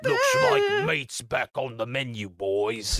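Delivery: orc quote. Retold or imitated. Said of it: "an orc from Lord of the Rings".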